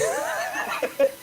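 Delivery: a quick, high, airless giggle; high breathy laugh. Real, not acted. Laughing at the fairy liquid joke, high giggle.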